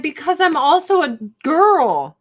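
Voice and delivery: loud, high, hammed-up reply; mock protesting wail. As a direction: wail it in comic self-defence. Acts out her tearful reply.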